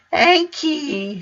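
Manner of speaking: put-on very high toddler voice; baby voice. A narrator's reading. Baby repeating thanks, very high toddler voice. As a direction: lisp it like a baby.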